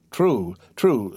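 plain reading voice

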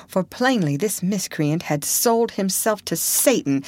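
plain reading voice

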